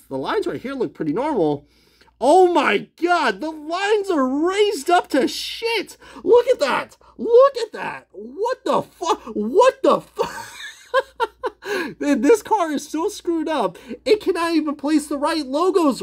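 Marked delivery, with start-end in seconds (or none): 2.2-13.8 higher, slow, stretched-out outrage; shocked disbelief. Real, not acted. Reviewer showing viewers the botched car lines, stunned and slow. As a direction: drag out each word in disbelief.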